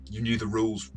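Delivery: ordinary talk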